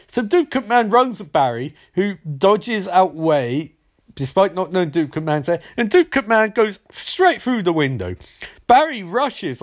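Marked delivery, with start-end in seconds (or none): none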